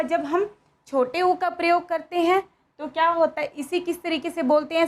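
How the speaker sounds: normal talking voice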